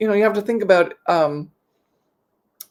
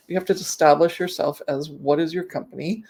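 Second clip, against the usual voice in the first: ordinary talk, nothing marked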